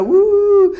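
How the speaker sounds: a hammed-up crying wail; mock crying son. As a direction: imitate a son wailing to his mother on the phone. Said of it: "empieza a llorar".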